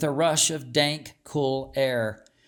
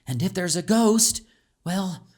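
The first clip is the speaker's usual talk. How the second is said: put-on higher, teasing child laying down the rules; bold young girl; 5 semitones higher than usual. A narrator's reading. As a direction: brave friend shrugging off the fear. Calixta answers friend.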